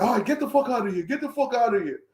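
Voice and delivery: high-pitched imitation of an impatient cop; mock dismissal. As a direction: shoo them off, high and hurried. Imitating officer dismissing him.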